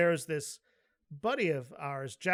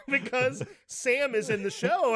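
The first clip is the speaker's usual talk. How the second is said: usual speaking voice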